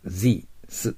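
Z held as a voiced buzz, not a J; teacher models a voiced Z sound. Shown as a demonstration. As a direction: model "Z" as a clear buzzing zzz. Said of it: "Gの発音じゃなくてZの発音です".

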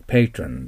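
patron said slowly as PAY-tron; pronunciation demo. Shown as a demonstration. Teacher showing how patron is said. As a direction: patron with PAY, never pa-to-ron. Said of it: "発音はpatronです".

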